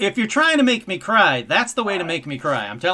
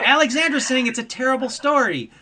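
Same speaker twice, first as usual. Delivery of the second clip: usual speaking voice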